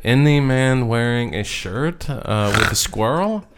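slow drawn-out mock accent; lightly voiced laid-back man, slowly; imitating Andre. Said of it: "would probably say something like this".

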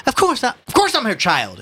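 high, pompous retort; indignant Ignatius. Acted. Ignatius answering the policeman's question, indignant. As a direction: answer as if the question were an insult.

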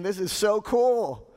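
high, thrilled and boastful; elated disciples. Acted. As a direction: gush like you're riding the glory train. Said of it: "the disciples were saying".

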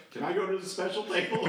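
put-on high, excited kid's plea; eager begging. Camper pestering his counselor, excited high plea. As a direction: beg like a thrilled camper.

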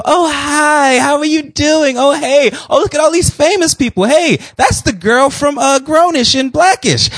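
high, excited fan voices calling hello; mock the fans fawning over her; gushing fan greetings. Mimicking fans greeting Miss Tina.

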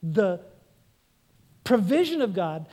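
ordinary delivery

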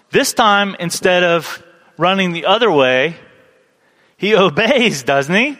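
ordinary delivery

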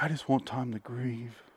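soft, mock-grieving plea; hushed appeal to the press; imitated press statement. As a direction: play the grieving husband, quietly. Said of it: "little press thing".

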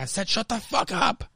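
strained high-pitched yell without real volume; mock scream. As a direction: imitate screaming with the volume held back. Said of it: "he screams".

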